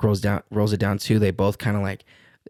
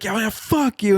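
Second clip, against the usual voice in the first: high-pitched taunting holler; mock parting yell; 13 semitones higher than usual. Driver yelling at the man while driving off, mock holler. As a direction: toss back a high, hammed-up yell.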